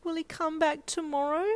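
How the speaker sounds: high, hopeful little voice; hopeful child. Acted. Little girl asking her mum hopefully, high. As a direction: ask with a child's hope. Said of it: "you asked hopefully".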